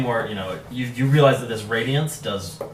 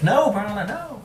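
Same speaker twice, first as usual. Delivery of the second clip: put-on high voice, climbing higher; mock pleading; 11 semitones higher than usual. Game master acting a character's plea to Barla, high mock protest.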